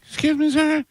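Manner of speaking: very high falsetto imitation of a woman; put-on female voice. Prankster asking a store shopper in a falsetto female voice. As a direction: squeak it out like a pretend woman. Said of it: "he does females".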